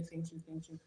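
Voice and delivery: normal talking voice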